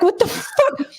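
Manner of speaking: high, stunned disbelief; shocked outburst. Retold or imitated. She recalls her shock at the cops, high and stunned. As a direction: squeak out total shock. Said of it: "I was like".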